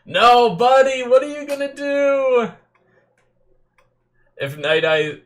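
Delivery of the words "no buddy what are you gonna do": much higher, louder, pleading with the screen; frantic worry for the character; panicked cry. Real, not acted. Fan fearing for the hero, high panicked plea.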